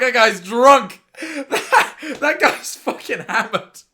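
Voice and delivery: loud, slow words broken by laughter; laughing shout. Real, not acted. Vlogger laughing loudly at the hammered guy on screen. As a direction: cackle through the words. Laughing at drunk man on video.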